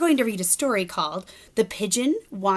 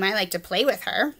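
plain reading voice

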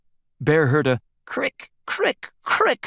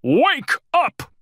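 high alarmed call; crying out; 4 dB louder than usual. A narrator's reading. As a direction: cry for Mouse in fright. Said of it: "he cried".

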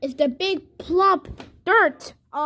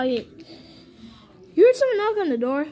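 ordinary delivery, nothing marked

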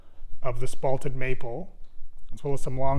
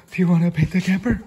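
a sharp gasp, then a louder, fast, rising question; gasping excited pet talk; 3 semitones higher, 7 dB louder, faster than usual. Acted. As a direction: gasp and ask the dog with big excitement.

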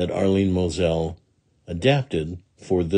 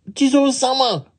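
very high-pitched demon cry; crying wicked Oni; 3 dB louder than usual. Acted. Wicked Oni to Jizo, high crying out. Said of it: "cried the wicked Oni".

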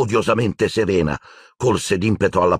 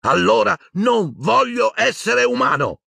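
much higher, raw yell; roaring. A narrator's reading. Grieving boy roaring at the headmaster. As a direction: tear it out of the throat. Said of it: "ruggì Harry".

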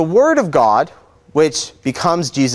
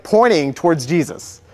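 normal talking voice

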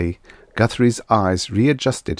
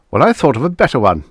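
quick, louder, irritable retort; snapped; 6 semitones higher, 6 dB louder, faster than usual. A narrator's reading. Guthrie overrides the girl's objection, snapping. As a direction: cut her off with a fast snap. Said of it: "snapped Guthrie".

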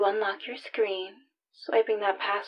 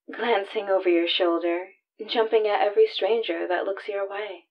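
ordinary talk

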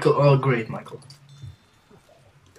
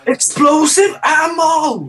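drawn-out, high excited call; 12 semitones higher, 6 dB louder, much slower than usual. Real, not acted. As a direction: stretch it out with glee.

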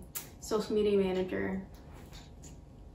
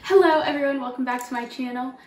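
high, loud host welcome; bubbly channel opener; vlog intro voice; 4 semitones higher, 7 dB louder than usual. Acted. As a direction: greet the viewers like a YouTuber.

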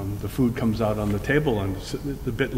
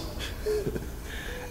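airy unvoiced chuckle; breathy laugh; 11 dB quieter than usual. Real, not acted. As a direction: let out a soft breathy laugh. Laughing at donut story.

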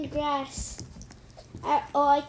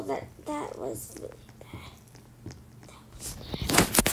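shaken whisper; 8 dB quieter than usual. Real, not acted. Shaken after near miss.

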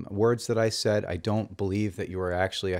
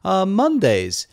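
high-pitched hammed-up British accent, a bit louder; British dialect; 8 semitones higher, 3 dB louder than usual. Host greeting his English friends in mock British accent. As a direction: open the show in an over-the-top British accent. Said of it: "that was my British dialect".

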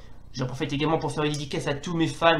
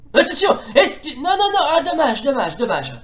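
excited commentator; 9 semitones higher, 4 dB louder than usual. Acted. Shot saved during commentary.

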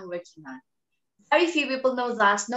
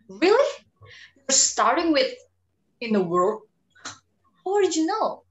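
mocking; 4 semitones higher than usual. Mocking the trailer opening.

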